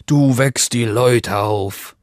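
slow put-on wolf voice; drawn-out wolf character voice; wolf complaining. A narrator's reading. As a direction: warn slowly as a storybook wolf. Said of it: "sagte der Wolf".

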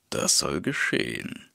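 low wolf voice, pleased; lightly voiced wolf, low and smug. A narrator's reading. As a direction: savour the flattery. Wolf agrees, flattered.

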